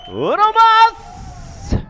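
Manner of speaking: drawn-out high cheer; hype shout. Retold or imitated. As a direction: call it out to the rider. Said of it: "what my friends say when we're wakeboarding".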